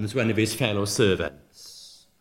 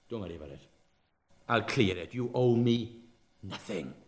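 much softer and lower, tender release of the debt; gentle forgiving master; 6 semitones lower, 10 dB quieter than usual. Retold or imitated. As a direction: hush it down like a kind master letting him off.

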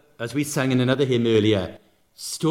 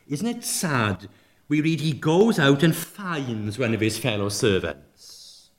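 ordinary talk, nothing marked

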